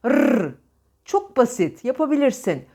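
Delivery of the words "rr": "Rr" as a bare rolled trill; isolated rolling "Rr"; tongue-trilled R. Shown as a demonstration. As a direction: vibrate the tongue on "Rr". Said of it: "Dilini içeride titret".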